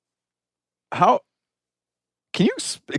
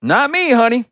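put-on high sweet old lady voice, much higher; old lady's reply; 11 semitones higher, 3 dB louder than usual. Caught old neighbor lady denies the theft, in a high sweet voice. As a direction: play the innocent old neighbor, brushing it off. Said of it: "old lady's reply, voiced".

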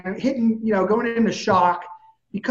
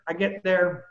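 usual speaking voice

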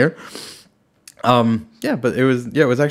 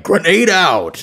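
slow, higher, louder callout; game soldier call; 6 semitones higher, 3 dB louder, slower than usual. Shown as a demonstration. Performing the line the kid requested, soldier call. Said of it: "can you say grenade out?".

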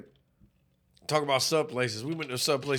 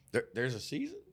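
lower, much softer puzzled voice; ditzy coworker; 4 semitones lower, 10 dB quieter than usual. Retold or imitated. Storyteller voicing his ditzy coworker, soft and puzzled. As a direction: ask it dumbly, baffled.

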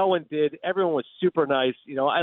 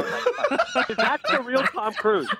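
laughing; 8 semitones higher, much slower than usual. Real, not acted. Mocking Tom Cruise, laughing.

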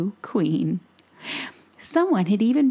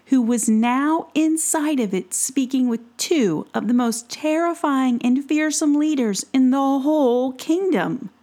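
usual speaking voice

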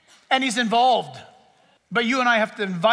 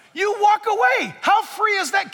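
shout; 9 semitones higher, 3 dB louder than usual. Real, not acted. Preacher's rising exhortation.

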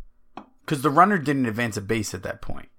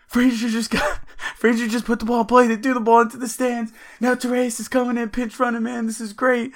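much higher, rushed and wound-up play-by-play; excited retelling; 11 semitones higher than usual. Retold or imitated. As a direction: gush it like the game's slipping away live. Retelling own play-by-play to friend.

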